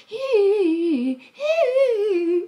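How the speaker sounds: high, loud mock "hi" vocal runs; mock studio vocal warmup; imitating singer. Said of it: "elle était là en mode".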